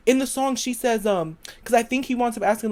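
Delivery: ordinary delivery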